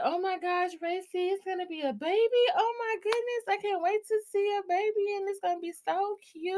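high gushing excitement; excited squeal. Retold or imitated. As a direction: squeal it like a thrilled kid. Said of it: "she was gonna be like".

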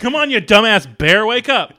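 high loud frustrated yell; mock yell. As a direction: holler at the sleeping bear. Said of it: "joking riff on the scene".

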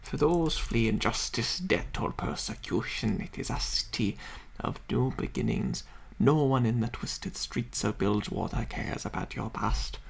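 soft lore narration; reading aloud. Acted. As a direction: read it like a game codex. Reads lore description aloud.

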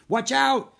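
high, very loud alarm; urgent shout to a friend in danger; shouted warning. A narrator's reading. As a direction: yell a sudden warning. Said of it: "Jack shouted".

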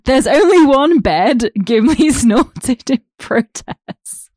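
slow, breaking into giggles; can barely read for laughing; laughing while reading. Real, not acted. Reading fanfic aloud, laughing.

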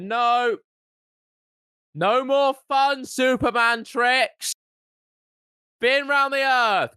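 high, slow, whining protest; mock whiny complaint. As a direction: whine it out like a fed-up scientist. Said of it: "they're like".

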